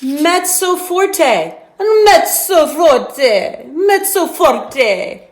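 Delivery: "mezzo forte" stretched out slowly, stress on "mezzo"; teacher modelling the term; slow teaching say. Shown as a demonstration. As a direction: lean on "mezzo" and draw out "forte". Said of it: "turn it up just a little bit louder to".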